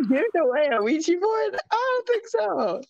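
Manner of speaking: high squealing laugh of refusal. Real, not acted. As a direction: laugh it out in high-pitched disbelief. Laughing at Ouija giveaway idea.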